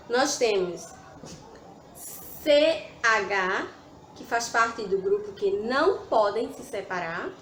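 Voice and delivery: ordinary delivery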